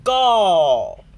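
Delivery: very loud high shout; shouting goal. A narrator's reading. Danny and Pedro shout as Richard scores, very loud. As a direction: celebrate the goal. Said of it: "shout Danny and Pedro together".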